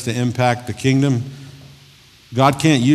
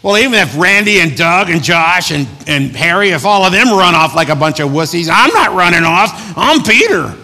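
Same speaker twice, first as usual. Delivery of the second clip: hammed-up bragging; 8 semitones higher, 9 dB louder than usual. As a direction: brag over everyone else's heads. Mock paraphrase of Peter's boast.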